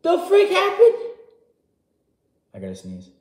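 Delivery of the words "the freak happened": much higher and louder, squeaking in shock; shocked disbelief. Retold or imitated. Fan seeing the character return, high-pitched shocked disbelief. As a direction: gasp it out like you can't believe she's back. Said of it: "And you're like".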